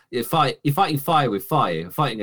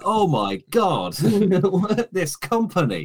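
mock exasperation; 8 semitones higher, slower than usual.

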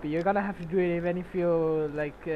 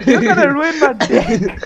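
laughing mock yell; 6 semitones higher, 10 dB louder, slower than usual. Retold quote to friend.

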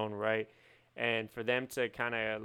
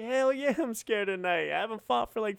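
much higher, loud, eager admission; excited high-pitched shout; 10 semitones higher, 4 dB louder than usual. Retold or imitated. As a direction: blurt it out high and thrilled. Voicing Tyson's excited answer.

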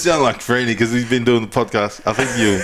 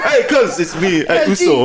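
high, laughing mock greeting; hammed-up high voice breaking into laughter; mock islander caller; 8 semitones higher, 3 dB louder than usual. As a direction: crack up while putting on a caller. Joke impression among laughing friends.

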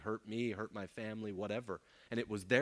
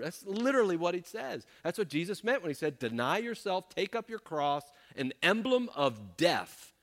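louder, higher, emphatic sermon rebuke; fiery preaching; 7 semitones higher, 6 dB louder than usual. Acted. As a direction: preach hard, driving each point home. Preaching at full force.

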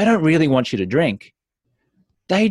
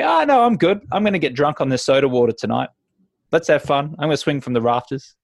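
higher, lively joking refusal; upbeat playful; 4 semitones higher than usual. Retold or imitated. Guest laughing off the host's pressure, higher and lively. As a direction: joke about soda water with a grin. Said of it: "you just say".

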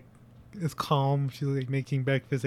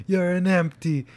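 acted scornful tirade; 5 semitones higher, 8 dB louder, slower than usual. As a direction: build to a loud, raised-pitch insult. Acting out the husband's cruel insult.

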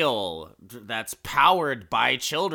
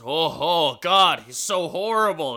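louder, higher crowd gasp of disgust; mock horror; 4 semitones higher, 6 dB louder than usual. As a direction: recoil like an appalled onlooker. Crowd recoiling at the freak.

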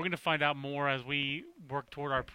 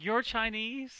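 higher, half-hearted sitcom character imitation; a hint of a put-on character voice; Estelle Costanza; 6 semitones higher, slower than usual. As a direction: lightly mimic an incredulous question, pitched up. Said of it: "I can't do an Estelle Costanza impersonation".

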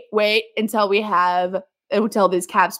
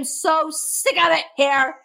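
fed up; 6 semitones higher than usual. Real, not acted. Weary Minnesota fan venting how sick she is of it, higher exasperation. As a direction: let the frustration spill out.

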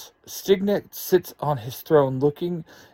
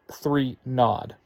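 ordinary delivery, nothing marked

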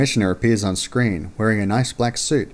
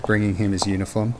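usual speaking voice

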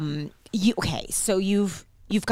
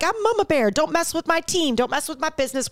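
higher-pitched mock Mama Bear warning; protective warning; 7 semitones higher than usual. Loosely calling herself Mama Bear, a high protective warning. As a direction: warn them off, pitched up.

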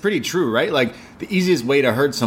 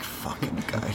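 unvoiced muttered aside, much softer; whispered aside; 13 dB quieter, slower than usual. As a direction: breathe it out as a sneering aside. Muttering jab at Michael Caine.

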